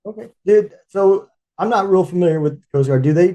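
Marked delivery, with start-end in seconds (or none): none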